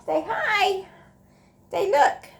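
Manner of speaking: high cooing pet talk. Real, not acted. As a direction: coax the cat to say hi. Talking to the cat.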